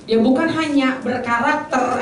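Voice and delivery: normal talking voice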